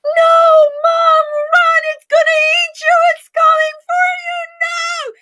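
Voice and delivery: put-on very high, loud, panicked child screaming; imitating a terrified scream. Boy warning his mom about the dinosaur, high panicked scream. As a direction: shriek like a kid watching his mom get eaten. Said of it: "screaming bloody murder".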